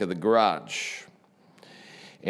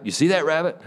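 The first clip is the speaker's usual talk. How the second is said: much higher, pressing insistence; urgent repeat; 7 semitones higher, faster than usual. Retold or imitated. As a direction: push the question harder. Neighbor repeats, more urgent.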